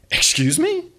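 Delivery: sharp high outraged question; mother outraged at her son's backtalk; outraged mom. Acted. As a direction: bristle at the insult.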